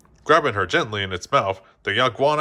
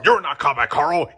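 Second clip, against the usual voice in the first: a hint of a louder barked correction; mild shout; 4 semitones higher, 4 dB louder than usual. A narrator's reading. Soldier mildly shouting a correction at Jessie. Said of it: "he shouted at her".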